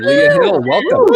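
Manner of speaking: high, loud showman welcome; grand welcome. Real, not acted. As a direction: announce the guest like a game show host.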